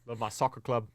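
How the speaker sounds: lightly put-on British accent. Shown as a demonstration. Co-host asks for a demo, attempted British accent. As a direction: have a casual go at a British accent. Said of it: "can you do a British accent".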